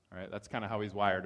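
ordinary talk, nothing marked

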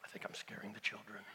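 quick whispered aside with a laugh. Real, not acted. Preacher joking about scaring the children, whispered. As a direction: keep whispering, amused at yourself.

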